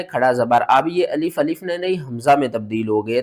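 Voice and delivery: usual speaking voice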